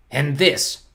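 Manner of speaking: a mild growl from the villain. A narrator's reading. Olaf boasts to Poe and orphans, a light growl. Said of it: "Count Olaf growled".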